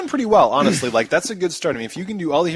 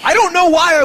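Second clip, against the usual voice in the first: baffled; 14 semitones higher, 7 dB louder than usual. Real, not acted. Exaggerated bafflement.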